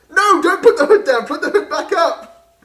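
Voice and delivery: high, loud alarmed objection; urgent protest. Acted. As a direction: stop them in alarm. Urgent protest mid-scene.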